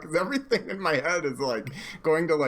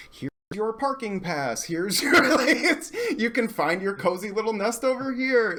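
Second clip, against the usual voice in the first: higher, playful host voice with a laugh; imitated welcome; 7 semitones higher than usual. Chat hosts settling a newcomer in, higher and playfully welcoming. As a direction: usher them in, half laughing. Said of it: "And it was like".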